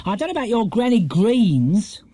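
high-pitched grim scolding. A narrator's reading. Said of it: "said Missus Bird grimly".

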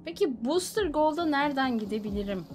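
normal talking voice

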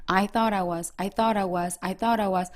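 "thought I" run together as one word; linked sounds. Shown as a demonstration. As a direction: link "thought" straight into "I", a little softly. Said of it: "끊지 마시고". ("thought I"를 끊지 말고 한 단어처럼 이어서)

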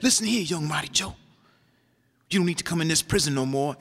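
lightly voiced older con, giving advice; a hint of a seasoned inmate counselling; Hard Rock's advice. Hard Rock advising young Mighty Joe, lightly voiced. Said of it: "he tells me that".

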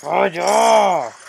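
loud, high angry yell; furious shout. Real, not acted. Player yelling at an enemy in the game, furious loud shout. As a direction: shout it at the screen. Raging at an online match.

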